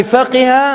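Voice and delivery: فقه read as "faqiha"; i-vowel on the qaf of فقه; kasra form. Shown as a demonstration. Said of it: "وأنها بالكسر".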